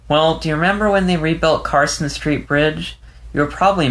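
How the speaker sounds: Leo, shaken and tearful. A narrator's reading. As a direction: let Leo's words tremble, lost and confused. Leo starting his story.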